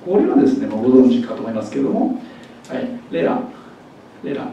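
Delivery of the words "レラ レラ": letterのTをラ行にして「レラ」; 舌を軽く弾いて「レラ」; ラ行になるT. Shown as a demonstration. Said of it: "ラリルレロになりますね". (ラ行化した「レラ」)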